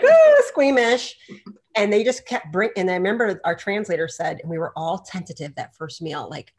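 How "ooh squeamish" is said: high, loud, drawn-out mock squeal; imitating squeamish girl. Retelling the girl's reaction to the food, mock squeamish squeal. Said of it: "the girl was like".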